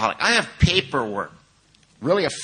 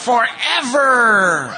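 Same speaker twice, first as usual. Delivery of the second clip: stretched very slow and much higher, half laughing; drawn-out forever; 11 semitones higher, 6 dB louder, much slower than usual. Retold or imitated. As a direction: drag the word out in mock horror, laughing. Retelling waking up thinking he's in hell.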